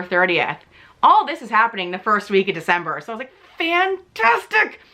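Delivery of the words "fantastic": high-pitched mock delight turning to complaint; sarcastic exasperation. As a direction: exclaim it with bitter sarcasm. Sarcastic reaction to lapsed insurance.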